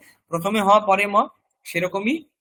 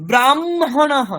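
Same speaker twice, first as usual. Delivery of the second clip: ordinary talk, nothing marked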